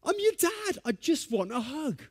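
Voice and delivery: high, pleading mock desperation; dad begging his son for a hug, high pleading. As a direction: beg for the hug, hammed-up. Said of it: "I said".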